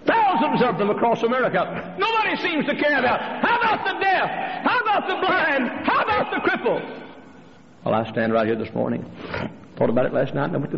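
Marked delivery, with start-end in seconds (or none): 0.1-7.0 very high-pitched preaching cry; impassioned shout. Real, not acted. Preacher pleading for the deaf and blind, impassioned shouting. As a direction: cry out in real outrage for the forgotten.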